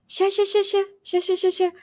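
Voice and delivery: high, rapid string of ща; brushing someone off, hurried; quick hurried ща. Shown as a demonstration. Teacher acts a person rushed, hurried ща. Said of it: "You can also use ща by itself".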